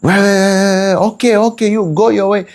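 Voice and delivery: high, dismissive breakup voice; fed-up partner. Retold or imitated. Partner walking out of the relationship, high and dismissive. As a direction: brush them off, pitched high. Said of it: "How do relationships end nowadays".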